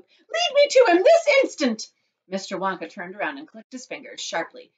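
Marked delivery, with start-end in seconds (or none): 0.0-1.9 high, loud, drawn-out command; angry snap. A narrator's reading. Mother orders chocolate maker onward, angry snapping. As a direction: order shrilly and furiously. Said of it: "snapped Missus Gloop".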